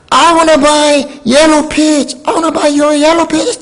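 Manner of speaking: high, drawn-out re-enacted yell; shouting out. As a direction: call out slowly to another driver, pitch way up. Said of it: "창문을 열고 내가 외쳤습니다".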